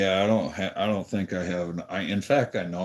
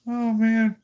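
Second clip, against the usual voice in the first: high excited exclaim; 7 dB louder than usual. Real, not acted.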